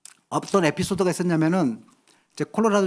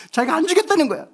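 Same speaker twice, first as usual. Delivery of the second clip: ordinary talk, nothing marked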